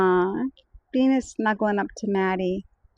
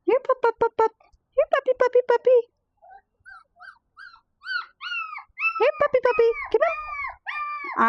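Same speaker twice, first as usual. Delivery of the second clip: high sing-song here puppy; calling puppies. Real, not acted. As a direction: call them in, high and bright.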